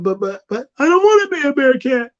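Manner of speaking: put-on high, whiny childish plea; whiny protest. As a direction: whine it like a sulking kid. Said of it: "You're like".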